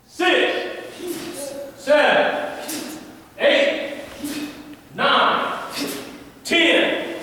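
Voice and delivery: loud, high counts shouted out; called drill counts. Real, not acted. Coach counts punches for the class, loud high calls. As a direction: bark each number separately.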